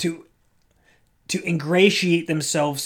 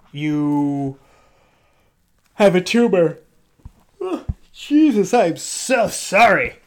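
a doctor delivering bad news, pitched higher and slower; mock sympathetic doctor; 4 semitones higher, 5 dB louder, slower than usual. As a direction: play an overly sorry doctor, stretching the words.

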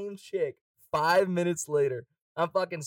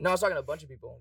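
normal talking voice

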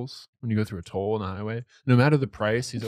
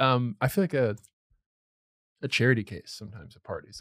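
normal talking voice

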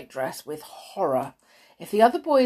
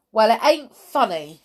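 louder, higher retort; indignant Cockney boy; 4 semitones higher, 8 dB louder than usual. A narrator's reading. George replies to matron, indignant. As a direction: answer back as a sulky Cockney lad.

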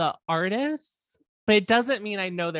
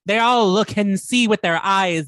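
hammed-up retort; 8 dB louder, slower than usual. Acted defiant quote, later heard as Irish.

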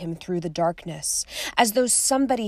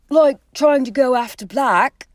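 louder, sharp retort to a friend; 6 semitones higher, 9 dB louder than usual. Acted. Ron cutting in sharply at Harry. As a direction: snap it out at him. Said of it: "said Ron sharply".

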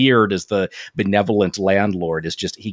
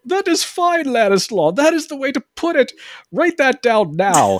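much higher, put-on fussy old gentleman voice; hammed-up Brooke; 3 dB louder than usual. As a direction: play him as a giddy pompous squire, pitched way up. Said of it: "And here's Brooke".

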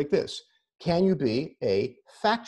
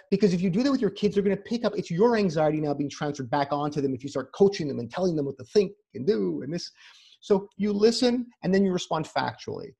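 normal talking voice

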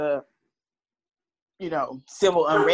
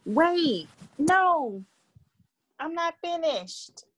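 protest; 9 semitones higher, much slower than usual. Real, not acted. Protesting being cut off.

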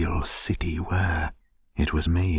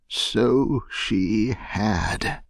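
drawn-out, slightly raised exclamation; heartfelt cry; 4 semitones higher, much slower than usual. A narrator's reading. Scrooge agreeing with the ghost about his sister, heartfelt cry. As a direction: agree with feeling, stretching it out. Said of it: "cried Scrooge".

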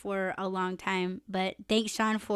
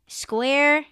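ordinary delivery

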